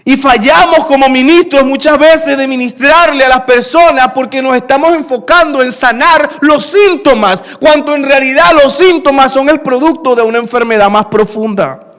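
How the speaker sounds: full preaching voice, building. Acted. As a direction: press the point home to the church. Preaching point to the congregation.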